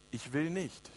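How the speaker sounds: soft, low, weary reply of a dying king. Acted. Dying king answering his fool, subdued and low. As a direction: answer softly, as if on your deathbed.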